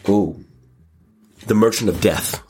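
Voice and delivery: ordinary talk, nothing marked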